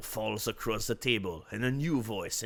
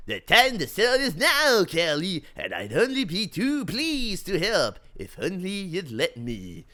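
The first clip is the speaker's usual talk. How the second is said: smooth salesman voice; 7 semitones higher, 6 dB louder than usual. Acted. Richard's slick sales pitch.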